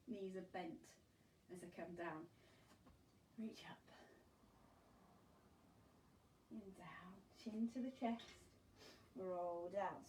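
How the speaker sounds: soft, calm guiding voice; soothing exercise cue. Acted. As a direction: guide the roll down gently. Guiding slow roll down.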